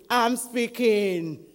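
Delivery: loud, slow booming answer; God in thunder. Acted. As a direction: stretch it out loudly as God thundering. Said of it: "And then thunder".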